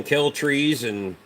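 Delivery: normal talking voice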